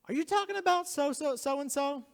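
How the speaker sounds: put-on high, loud little kid voice; mimicking four-year-old. Father imitating his four-year-old son, high and loud. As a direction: squeak it up like a small boy asking. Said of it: "he goes".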